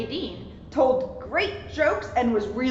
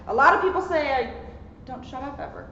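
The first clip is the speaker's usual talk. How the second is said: ordinary delivery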